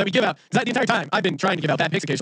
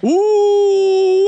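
high, loud, drawn-out "ooh"; long hyped whoop; 14 semitones higher, 7 dB louder than usual. Real, not acted. Host opening the sports gambling podcast, long whoop. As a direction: kick off the show with a sustained whoop.

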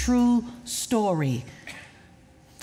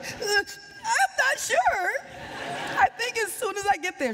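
high flustered answer; 11 semitones higher than usual. Retold or imitated. A fan asks when she goes on, high and flustered. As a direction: stammer it out, caught off guard.

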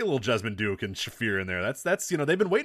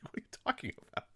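confused whisper; 13 semitones higher, 11 dB quieter, much faster than usual. Retold or imitated. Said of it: "I'm like".

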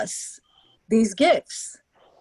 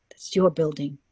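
whisper; 6 semitones lower than usual. Pretend. The building speaks to them.

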